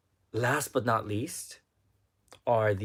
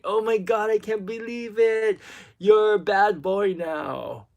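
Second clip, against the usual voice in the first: higher, louder mock-shocked scolding; shocked husband; 10 semitones higher, 8 dB louder than usual. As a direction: play the scandalized partner, teasing. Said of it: "He's like".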